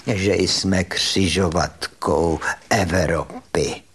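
slow, low, quavering professor impression; V. V. Štech. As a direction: stretch it out in an imitated trembling voice. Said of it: "mluvil takovým roztřeseným hlasem".

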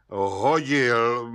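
drawn-out, mulish answer in a put-on voice; stubborn mumble. A narrator's reading. Suspect answering the judge, mumbling with ram-like stubbornness. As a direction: mumble it doggedly, refusing to budge. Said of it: "mumlal Václav Lisický s beraní tvrdohlavostí".

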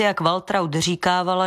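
usual speaking voice

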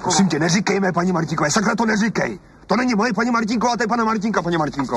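snapping back, voice climbing; angry outburst. Acted. Husband rejects his wife's jealous jab, angrily. Newlyweds' quarrel flares up.

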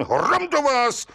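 high, drawn-out exclamation of outrage; judge's outburst. A narrator's reading. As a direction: explode at the culprit, pitch leaping up. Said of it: "rozklikl se soudce".

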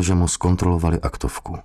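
ordinary delivery, nothing marked

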